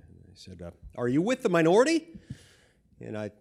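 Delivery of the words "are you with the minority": imitated guard's demanding question, high and loud; loud challenge. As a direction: challenge him sharply, pitch rising at the end. Guard challenging him, retold.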